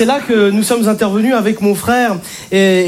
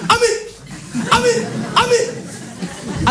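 much higher, slow, barking yelps; barking dad; 12 semitones higher, 4 dB quieter, much slower than usual. Retold or imitated. An angry father hearing his daughter's pregnancy, barking like a dog. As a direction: bark like a furious dog. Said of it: "les aboiements que vous entendez".

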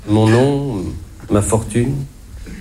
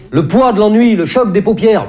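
slogan ampoulé; 9 semitones higher than usual. Acted. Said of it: "Le Monde".

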